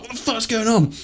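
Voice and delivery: crazy reaction. Retold or imitated. Bystander reacting to a man smashed against a wall, crazed alarm. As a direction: squeal it in disbelief. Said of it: "obviously I react crazy".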